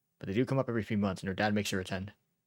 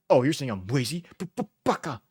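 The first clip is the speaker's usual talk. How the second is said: higher, louder, stuttering mock outrage; mock indignation; 4 semitones higher, 5 dB louder, slower than usual. Teasing reply to being called lazy, mock indignant. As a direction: play offended, hammed up, stammering the insult.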